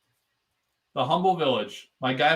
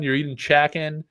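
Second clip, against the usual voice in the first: ordinary talk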